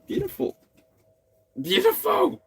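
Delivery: delighted gush. Real, not acted. Reacting to a sweet viewer comment.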